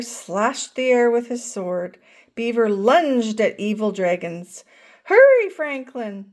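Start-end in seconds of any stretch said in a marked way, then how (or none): 5.0-6.3 loud call to a friend. A narrator's reading. Bear calls Franklin to join the game, loud call. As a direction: shout across the yard to hurry him. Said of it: "shouted Bear".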